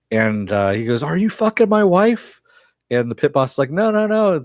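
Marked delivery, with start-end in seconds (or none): 1.0-2.4 high, incredulous accusing cry; stunned husband's high accusation; shocked accusation. Retold or imitated. Husband confronts pit boss, high-pitched. 3.7-4.4 quick high panicked denials; flustered denial. Retold or imitated. Pit boss denies, high pitch.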